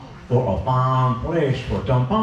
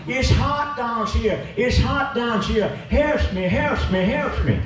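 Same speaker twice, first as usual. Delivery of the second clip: wailing sinners; 8 semitones higher than usual. Acted. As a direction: moan it like the crowd roasting in hell. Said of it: "Folks be sweat on and shout on".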